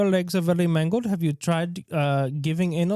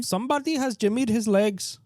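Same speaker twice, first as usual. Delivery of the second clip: put-on higher Indian accent, lightly done; a clumsy Indian accent; mock Indian accent; 6 semitones higher than usual. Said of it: "Indian accent".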